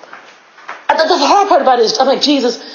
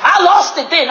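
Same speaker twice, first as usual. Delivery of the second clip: ordinary delivery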